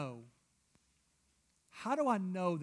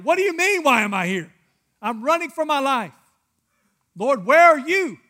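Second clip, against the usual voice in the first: high, full-voiced indignant outcry; shouted protest; 9 semitones higher, 19 dB louder than usual. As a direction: shout it like a hammed-up desperate fugitive. Scared Elijah protests to God.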